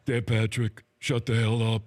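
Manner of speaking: low, put-on voice of the guest; guest impersonation. Host impersonating his guest to his face, low mock telling-off. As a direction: drop low and tell him off as the guest. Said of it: "my impersonation with you".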